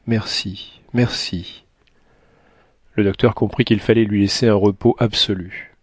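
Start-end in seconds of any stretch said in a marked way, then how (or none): 0.1-1.6 faint, softened thanks; weak, quiet voice; barely able to speak. A narrator's reading. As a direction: say it softly, as if strength is nearly gone. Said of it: "eut à peine la force de dire".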